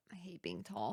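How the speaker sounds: soft, whispered grumble; hushed aside. Real, not acted. Tall sister agreeing in the debate, whispered complaint. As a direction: mutter it under your breath.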